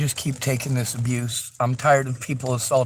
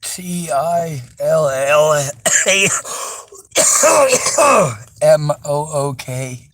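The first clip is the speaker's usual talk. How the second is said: slow spelling; slower than usual. Real, not acted. Spelling town name letter by letter.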